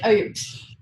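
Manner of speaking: dismissive scoff. Retold or imitated. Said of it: "I just poo-pooed it".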